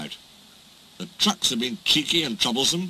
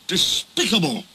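higher, emphatic condemnation; outraged; 6 semitones higher than usual. Acted. Henry finishes the engines' chorus of protest, outraged. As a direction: pronounce a verdict of disapproval.

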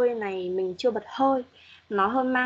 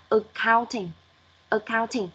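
"accounting" with a weak first syllable, COUNT raised; stress on second syllable; slower than usual. Shown as a demonstration. Teacher correcting Xuân on "accounting", second-syllable stress. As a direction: slowly model ac-COUNT-ing, stressing the middle. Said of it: "giảm ở âm đầu và lên ở âm số hai".

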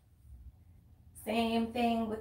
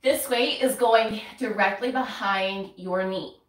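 ordinary delivery

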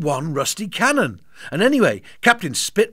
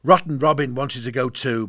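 usual speaking voice

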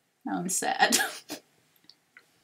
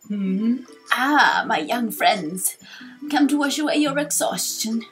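slow, wavering elder with drawn-out words; wise old sage; 4 dB louder than usual. Acted. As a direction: play a kindly old sage pondering aloud. Voices old sage Torkoal.

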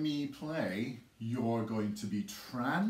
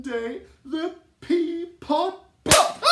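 high, loud shout on popped; 11 dB louder than usual. Shown as a demonstration. Teacher shouting the pop with the class. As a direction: burst out on popped. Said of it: "You shouted so loud when we said popped".

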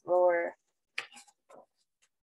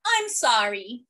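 usual speaking voice

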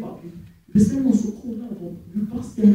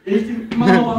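normal talking voice

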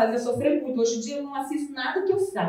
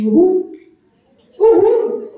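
high, loud whoop of joy; joyful rising whoop; cheer; 6 semitones higher, 9 dB louder, much slower than usual. Real, not acted. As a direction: celebrate with a big cheer. Cheering the audience's success.

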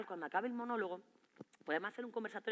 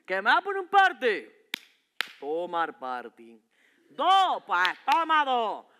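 put-on teen talking back; 3 semitones lower, 4 dB louder, much slower than usual. Teacher and teen trade detention slips.

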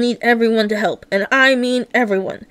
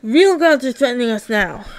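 ordinary talk

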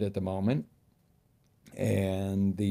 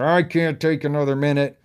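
loud, higher desperate cry; fed-up outburst; 6 semitones higher, 9 dB louder than usual. Shown as a demonstration. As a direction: blurt it out like you've hit your limit. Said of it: "If you're at that point where".

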